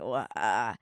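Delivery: low, soft false start before the word; imitated stutter. Showing how they stutter before long A words, hesitant stutter. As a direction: stumble quietly before the tricky vowel. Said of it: "stutter before I start any words".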